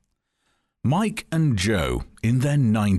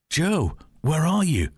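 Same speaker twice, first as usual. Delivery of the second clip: startled call; 9 semitones higher than usual. A narrator's reading. Mike calls into the dark.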